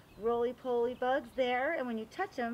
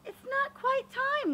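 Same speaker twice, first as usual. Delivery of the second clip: Nana's voice; 5 dB louder, slower than usual. Acted. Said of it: "Nana says".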